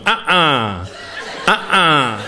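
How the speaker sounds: car starter impression. Shown as a demonstration. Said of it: "Here's another one".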